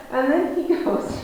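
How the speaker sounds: ordinary talk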